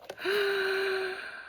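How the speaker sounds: high soft gasp of delight. Real, not acted. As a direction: catch your breath in wonder. Gasp at new gift.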